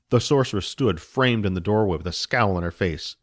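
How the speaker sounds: ordinary delivery, nothing marked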